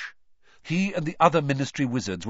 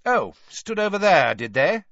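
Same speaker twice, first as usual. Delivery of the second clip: loud, raised sarcastic disbelief, rising tag; disbelieving; 6 semitones higher, 7 dB louder than usual. Acted. Mister Crouch turning on Hermione, disbelieving. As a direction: scoff with a mocking questioning lift. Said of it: "Disbelief etched all over his face".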